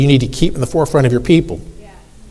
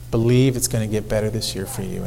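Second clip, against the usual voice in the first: usual speaking voice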